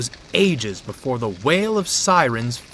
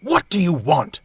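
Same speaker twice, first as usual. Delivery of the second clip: louder, cross scolding; angry scolding; 5 semitones higher, 7 dB louder than usual. A narrator's reading. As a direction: scold the intruder for waking you. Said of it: "Dudley scowled".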